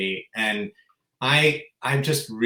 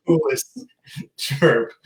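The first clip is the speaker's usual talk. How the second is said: normal talking voice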